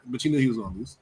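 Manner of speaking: ordinary talk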